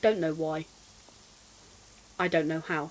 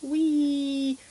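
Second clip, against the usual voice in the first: playful whee; 5 semitones higher than usual. Real, not acted. Gleeful cry while playing.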